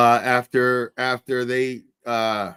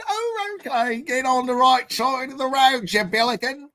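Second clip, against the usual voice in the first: mock Australian accent; 14 semitones higher than usual. Host jokes with Aussie caller.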